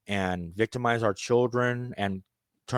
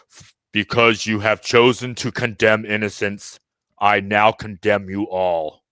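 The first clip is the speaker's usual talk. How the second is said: slow, loud booming voice from the heavens; the sky passing judgement, slow and loud; 6 dB louder, slower than usual. Acted. The sky speaks to humanity, slow booming judgement. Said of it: "the sky splits open and it says".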